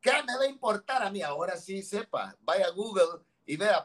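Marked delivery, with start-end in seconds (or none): 0.0-1.2 dismissive. Retold or imitated. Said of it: "Y usted dijo".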